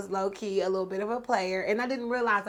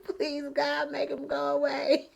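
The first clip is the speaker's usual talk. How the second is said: high, lightly desperate retold plea; mock pleading prayer; 7 semitones higher than usual. Woman silently begs God to remove her ex, mock pleading. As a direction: pray up high for rescue, comically. Acted prayer for him to leave.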